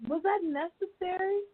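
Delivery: high-pitched, indignant outburst of disbelief; appalled disbelief. Retold or imitated. Reacting to a rude man at the rally, high incredulous outrage. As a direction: throw the questions back, shocked and offended. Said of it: "And I'm like".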